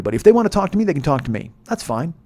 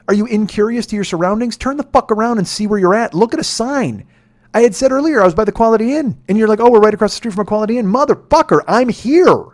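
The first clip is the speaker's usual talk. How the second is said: exasperated rant; 8 semitones higher, 7 dB louder than usual. Acted. Ranting about riders not seeing him.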